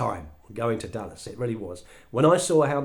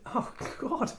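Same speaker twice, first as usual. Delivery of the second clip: breathless gasped oh god; winded by the number; stunned gasp; 13 semitones higher, 6 dB quieter than usual. Retold or imitated. Humbled speaker reacting to Roger, breathy gasp. Shock at the millions.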